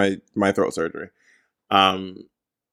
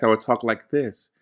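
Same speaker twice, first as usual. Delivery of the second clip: softer, guarded delivery; held-back, cautious talking; careful podcast voice; 3 semitones lower, 7 dB quieter than usual. Shown as a demonstration. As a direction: speak gently as if afraid to hurt your voice. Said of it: "I was afraid to hurt my voice".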